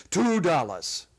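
loud, curt big-man voice; gruff giant. Acted. Ha Ha telling the kids the price of the bottle, loud and curt. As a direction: state the price flatly and loud.

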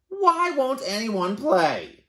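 high, loud, slow whine; whiny bird. Acted. Bird complaining nobody will play, whiny. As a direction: whine like a bored child.

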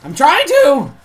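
high, loud flustered retort; loud, frustrated comeback; exasperated shout. Real, not acted. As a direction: yell back in protest. Flustered retort to stop jumping.

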